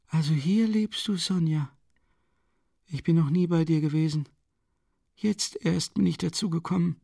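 high, frail voice of a dying mother; dying woman voice. A narrator's reading. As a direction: plead feebly with the children. Dying mother speaks in story.

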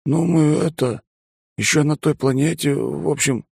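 high flustered excuse; stumbling through an excuse; embarrassed mumbling pilot. Acted. Bond stammering excuses.

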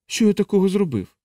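high, anxious plea; growing alarm. Acted. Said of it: "з наростаючою тривогою".

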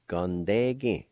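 Gondegi, slow and careful; slow model word. Shown as a demonstration. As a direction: model Gondegi slowly for learners. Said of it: "it will sound like this".

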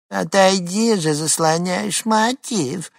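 high squeaky mimicking voice; mock her in a thin squeaky voice. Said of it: "передразнил он писклявым голосом". (писклявый передразнивающий голос)

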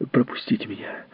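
breathless cry; gasping for air while pleading; urgent panting report. Acted. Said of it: "задыхаясь, крикнула она". (задыхаясь, с мольбой)

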